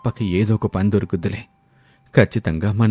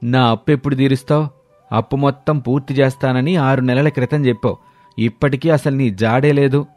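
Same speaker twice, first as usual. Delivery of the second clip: angry demand; 5 semitones higher than usual. Acted. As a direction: press him hard for the money. Moneylender demands debt repayment.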